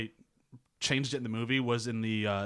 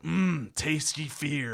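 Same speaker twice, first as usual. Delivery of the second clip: savoring Pennywise; 4 semitones higher, much slower than usual. Retold or imitated. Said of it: "he's holding up Bev and he's like".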